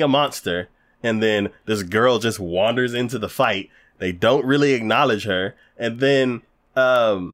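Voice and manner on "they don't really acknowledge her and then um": ordinary delivery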